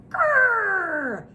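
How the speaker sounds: high, loud playful growl; pretend bear growl. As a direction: growl like a woken bear for kids. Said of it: "and goes".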